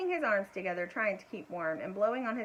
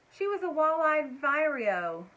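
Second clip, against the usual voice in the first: slow, louder know-it-all tone; scientific; 6 semitones higher, 6 dB louder, slower than usual. A narrator's reading. George naming the bird, scientific tone. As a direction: state it like an expert. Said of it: "said George scientifically".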